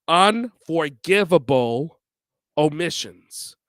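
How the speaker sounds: Unforgivable drawn out slow with a rise, then omissions falls; stressed slowly. Shown as a demonstration. Vlogger coining his category title, slow and stressed. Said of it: "That's how I term this".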